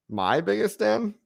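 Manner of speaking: high, slow puzzled echo; a bemused millennial; baffled. Real, not acted. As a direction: repeat the word like it is foreign. Puzzled at Gen Z word.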